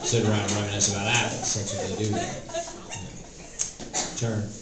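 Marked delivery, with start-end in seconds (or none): none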